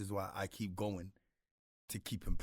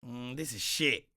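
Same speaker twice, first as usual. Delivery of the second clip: imitating brother; 3 semitones higher, 6 dB louder, slower than usual. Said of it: "all I can hear in his voice".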